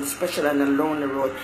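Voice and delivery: normal talking voice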